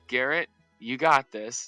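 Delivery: ordinary talk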